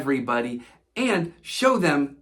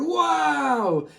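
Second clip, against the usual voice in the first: mild holler; 9 semitones higher than usual. Acted.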